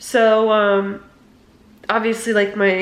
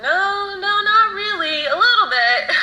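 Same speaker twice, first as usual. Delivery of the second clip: high-pitched voice for grandfather; retold grandfather's answer, pitched way up; imitating grandfather; 11 semitones higher than usual. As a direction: lift it high, breaking into a laugh. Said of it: "And he said".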